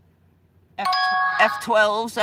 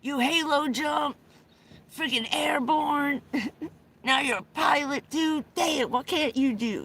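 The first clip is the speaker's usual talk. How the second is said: excited friend; 7 semitones higher than usual. Retold or imitated. Friend marvels at the father's career, high excited shout. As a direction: gush at him like he's your hero. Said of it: "He said".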